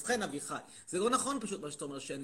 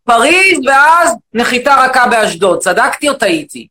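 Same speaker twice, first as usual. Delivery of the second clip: shouting, indignant rant at the audience; loud mock rant; 9 semitones higher, 28 dB louder than usual. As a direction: bellow it like an outraged demagogue. Loud persona guesses origin.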